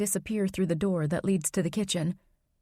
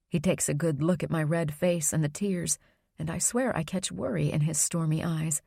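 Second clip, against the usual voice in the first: plain reading voice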